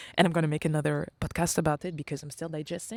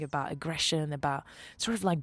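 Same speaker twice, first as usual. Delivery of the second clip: ordinary talk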